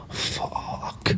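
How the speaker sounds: "Fuck" drawn out on little voice, ending in a hard CK; dragged-out curse, thrown CK. Acted. Belfast ma finishing her rant, "Fuck" dragged out with the CK thrown. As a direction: throw the CK of a long, hushed "Fuck". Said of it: "she throws the C K at you".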